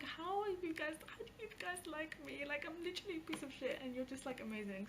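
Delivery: high, fast, gushing; gushing disbelief. Retold or imitated. To fans in her comments, high gushing disbelief. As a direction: squeal it out, amazed they like her.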